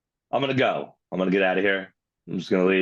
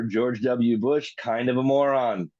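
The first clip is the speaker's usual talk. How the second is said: ordinary talk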